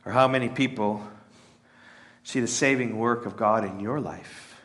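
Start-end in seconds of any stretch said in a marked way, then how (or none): none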